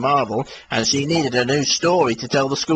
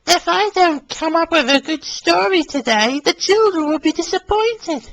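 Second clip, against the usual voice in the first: high, worried woman's voice with a sigh; high, downcast fretting; sad Mary Marvel. Acted. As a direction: sigh it, sadly and pitched up. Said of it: "she sighed sadly".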